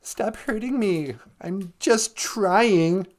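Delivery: high, drawn-out mock whine; mock bellyaching. Comic mimicking complainers from scripture, slow high whine. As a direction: stretch out a hammed-up, wounded complaint. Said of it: "That's my impression of".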